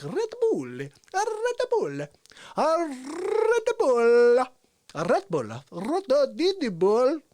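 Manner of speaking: Jim Carrey. Retold or imitated. Recalling a comic's drink-name routine, wildly elastic delivery. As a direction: stretch and twist each repeat, manic and cartoonish. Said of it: "Jim Carrey. Be like".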